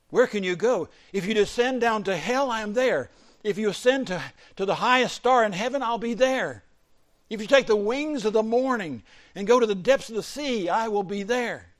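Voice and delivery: loud, high, ringing declaration; full-force preaching. Acted. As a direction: proclaim it as God's own challenge. Preaches God's words at full force.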